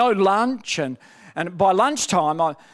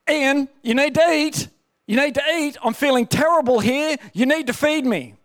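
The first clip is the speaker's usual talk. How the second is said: higher, pushy demanding nag; insistent flesh voice; 5 semitones higher than usual. Retold or imitated. His flesh demanding food on the second day, higher insistent nag. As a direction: keep pressing, the craving is winning. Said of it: "And my flesh is going".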